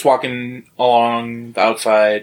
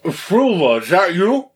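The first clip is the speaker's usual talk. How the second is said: offscreen NPC calling; 7 semitones higher than usual. Acted. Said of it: "you do hear from the next room".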